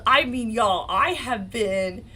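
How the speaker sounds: ordinary talk, nothing marked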